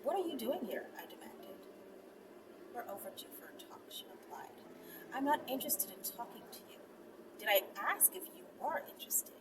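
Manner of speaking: hushed, intimate storytelling voice; soft intimate narration; soft story reading. A narrator's reading. Reads erotic story softly with music.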